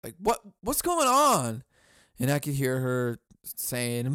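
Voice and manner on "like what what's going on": pretend yelling. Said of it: "picture myself yelling at her like".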